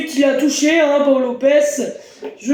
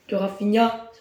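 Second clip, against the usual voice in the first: ordinary talk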